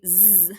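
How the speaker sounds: Z as a buzzed "zzz", not the letter name; buzzing Z sound. Shown as a demonstration. Teacher reminds students what S can say, buzzing Z sound. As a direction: buzz out Z. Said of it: "S can say Z".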